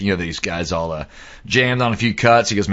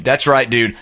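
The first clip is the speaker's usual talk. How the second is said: plain reading voice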